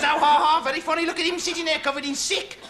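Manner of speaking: loud, high sarcastic jeer with a fake laugh; mocking conductor. Conductor jeering at the passenger, sarcastic and loud. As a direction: sneer at the passenger with a mock laugh.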